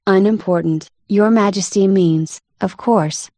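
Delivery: usual speaking voice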